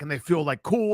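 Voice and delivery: usual speaking voice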